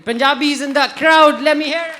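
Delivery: high, loud call to the audience; rally the whole room with a big shout; crowd shout. Real, not acted. Comic to Punjabis in the crowd, loud rousing call.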